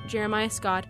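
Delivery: ordinary talk, nothing marked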